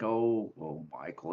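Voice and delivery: put-on soft, low, let-down sigh at the boy; weary sigh. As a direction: sigh his name like a tired father. Sheriff sighs at son's werewolf story.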